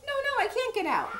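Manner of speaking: high, fast acted protest; hammed-up little frog panic; panicky refusal. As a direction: blurt it out in a fluster. Froggy refuses to leave the water.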